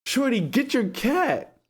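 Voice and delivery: high, slow, pained plea; pleading quote. Retold or imitated. He begs her to remove the cat, high and slow. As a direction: beg in a strained high voice. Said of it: "I said".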